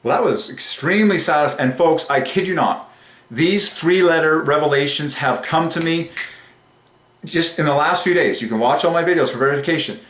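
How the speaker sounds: overjoyed testimony to followers; excited. Real, not acted. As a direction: gush with thrilled conviction. Thrilled by his own revelations.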